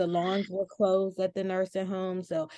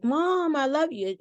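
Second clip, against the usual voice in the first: high, raised call to mom; 8 semitones higher, 4 dB louder than usual. Retold or imitated. Daughter calling to her mother from a drive-by, calling out. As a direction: imitate a drawn-out call from afar.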